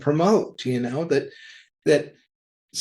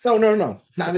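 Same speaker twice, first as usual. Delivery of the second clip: urgent refusal; 8 semitones higher, 4 dB louder, much faster than usual. Retold or imitated. Acts out rejecting issue twenty-five.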